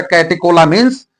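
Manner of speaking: कैटेकोलामीन्स said with a hard k, "कैटे-को-लामीन्स"; the old way: कैटेकोलामीन्स with k in the middle; old pronunciation. Shown as a demonstration. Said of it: "पहले मैं pronunciation करता था".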